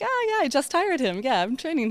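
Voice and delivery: high, breezy sarcastic reply. Retold or imitated. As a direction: brush them off with a joke. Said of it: "I was like".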